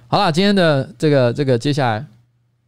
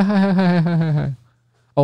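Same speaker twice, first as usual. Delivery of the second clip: ordinary talk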